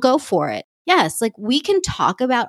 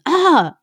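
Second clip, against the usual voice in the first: a sharp, high exasperated ugh; high fed-up ugh; frustrated groan; 8 semitones higher than usual. Real, not acted. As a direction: let the frustration burst out in one high groan. Venting frustration at friend.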